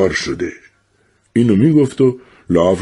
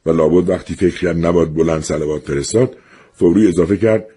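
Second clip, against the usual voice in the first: ordinary delivery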